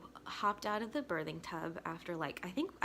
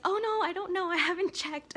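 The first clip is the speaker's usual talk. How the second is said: high, loud retold panic; imitating a flustered midwife; flustered backtracking; 11 semitones higher, 7 dB louder than usual. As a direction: hurriedly take it back, voice pitched up.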